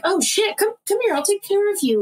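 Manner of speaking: high-pitched fussing over the injured; concerned woman. Retold or imitated. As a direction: imitate her rushing to help, voice way up. Acted woman tending injured man.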